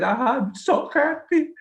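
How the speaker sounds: high, loud voice breaking with happy tears; overwhelmed joy through tears to Radheshyam; tearful happy cry. Retold or imitated. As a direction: relive sobbing out the joy. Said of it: "and crying like".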